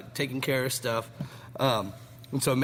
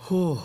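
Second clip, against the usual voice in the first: sharp exhale from spicy heat; hot-mouth whew; 5 semitones higher, 5 dB louder than usual. Real, not acted. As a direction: blow out the burn. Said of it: "while my mouth is on fire".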